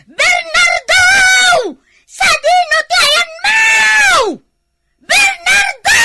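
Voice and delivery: high, loud shout of his name; calling out. Acted. Wife calls for her missing husband, loud high shout. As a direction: yell for him across the yard.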